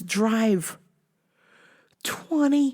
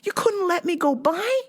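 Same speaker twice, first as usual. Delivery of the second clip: incredulous cursing; 8 semitones higher than usual. Retold or imitated. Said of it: "I'm like".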